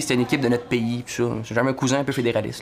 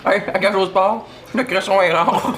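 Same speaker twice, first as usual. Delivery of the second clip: higher, amused ad pitch; annonce cool qui s'assume; 5 semitones higher, slower than usual. Acted. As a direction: sell it like a cheeky commercial. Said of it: "les annonces un peu cool, genre ils s'assument". (pub décontractée, assumée et rieuse)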